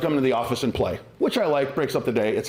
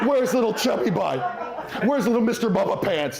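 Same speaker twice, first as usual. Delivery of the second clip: put-on higher, playful baby talk to a dog; silly dog voice; 7 semitones higher than usual. Shown as a demonstration. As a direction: coo at the puppy like a toddler. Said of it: "that cute-ass voice that we all use".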